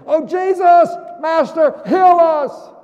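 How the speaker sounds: high, loud begging call; pleading cry. Pretend. Lepers calling loudly to Jesus, begging.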